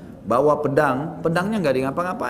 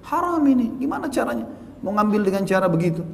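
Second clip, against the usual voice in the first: higher, indignant outburst; indignant exclamation; 7 semitones higher than usual. Real, not acted. Preacher denouncing the money from staged crashes, indignant. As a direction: cry out against the wrong.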